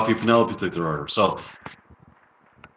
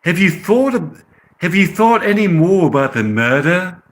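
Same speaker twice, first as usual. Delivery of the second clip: put-on higher-pitched amateur British accent; English accent; 6 semitones higher than usual. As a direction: ask it in put-on English tones. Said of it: "with a English accent".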